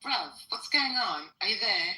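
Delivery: calling out. Real, not acted. Calling out to silent friend.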